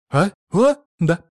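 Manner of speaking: put-on three quick, separate kids' replies; children responding. A narrator's reading. Three pupils answer their teacher's call, brisk. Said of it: "откликнулись трое шилоприводных бесят".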